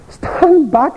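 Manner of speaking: lightly voiced old teacher, high and kindly; a hint of a debating master coaching a pupil; teacher's advice. Debating master to his pupil, lightly voiced old teacher. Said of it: "He says, "Bingham".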